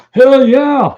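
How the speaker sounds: imitated whoop, high and loud; eager agreement. Author retelling a cab driver's keen yes, excited and loud. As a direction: jump at the offer, loud and high.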